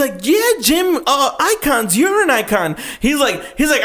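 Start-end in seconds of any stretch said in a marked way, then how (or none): 0.2-2.8 high, bouncy mock interviewer; gushing interviewer. Retelling an interviewer flattering Jim Carrey, mock gushing. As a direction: imitate a bubbly red-carpet host.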